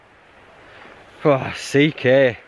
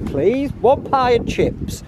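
pleading order; 8 semitones higher, slower than usual. Pretend. Pleading for pie, mock order.

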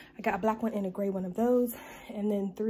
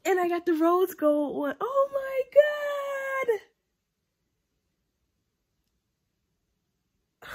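high, loud gushing; real thrilled excitement; 14 semitones higher, 7 dB louder than usual. Real, not acted. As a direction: rise into a delighted squeal. Thrilled by the gift's color.

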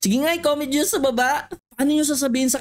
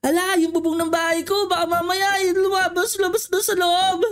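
mock crying; 6 semitones higher than usual. Worries about leaking roof, sobbing.

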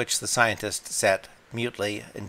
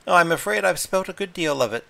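high, louder flustered apology; Lady Blemley apologising for spilt milk, high and louder; apologetic; 9 semitones higher, 7 dB louder than usual. A narrator's reading. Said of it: "she said apologetically".

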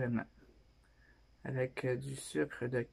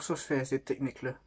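speaking past a bottle neck; about to drink; bottle held at mouth; faster than usual. Real, not acted. Seen 0: bottle neck under lips, touches lips at 1.2, then drinks.

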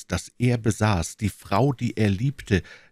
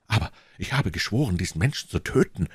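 ordinary delivery, nothing marked

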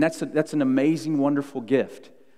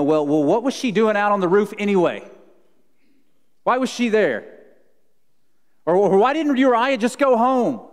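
mock blame-shifting; 8 semitones higher, 6 dB louder than usual. Mock excuse-making blame game.

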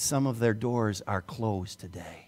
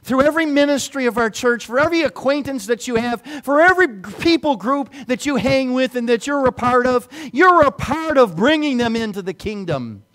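louder, higher preacher push; full-force preaching; 11 semitones higher, 11 dB louder than usual. Acted. Pastor rallies the church to bring people in, preaching hard. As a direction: drive it home to the congregation.

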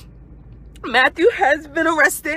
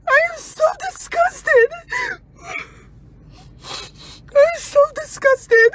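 higher, crying out in disgust; enraged and disgusted; 10 semitones higher than usual. Real, not acted. Mother denouncing the bishop to viewers, crying out in disgust. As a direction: let it break high each time you say it.

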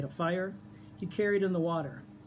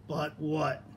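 growl. A narrator's reading. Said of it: "growled the fox".

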